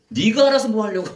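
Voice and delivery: high, loud mock defiance; defiant retort. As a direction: act out a demon talking back to the pastor. Possessed person defies the pastor.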